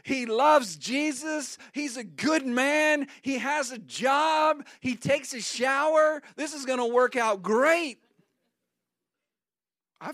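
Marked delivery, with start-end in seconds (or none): 0.0-8.0 high, loud, eager voice; hammed-up excitement, higher and louder; giddy hopeful. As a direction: gush about the perfect boyfriend. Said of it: "Have you ever felt like".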